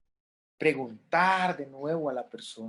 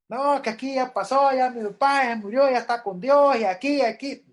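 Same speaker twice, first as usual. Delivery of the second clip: higher and louder mock of a hurried son; dismissive relative; 7 semitones higher, 6 dB louder than usual. Son telling the widow the father is gone, mock dismissive. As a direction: brush off the grief like it's done.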